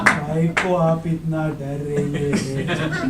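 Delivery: slow, stretched-out speech; slow motion. Acted. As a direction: drag every word out slowly. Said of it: "Ramesh जी इतना slow motion में क्यों बात कर रहे हैं?".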